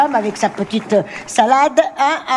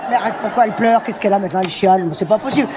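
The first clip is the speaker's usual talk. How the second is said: normal talking voice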